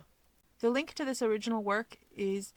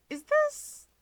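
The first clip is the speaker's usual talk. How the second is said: high, hesitant, half-asked question. Acted. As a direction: trail off unsure what you see. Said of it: "Rumi hesitates".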